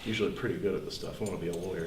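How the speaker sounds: usual speaking voice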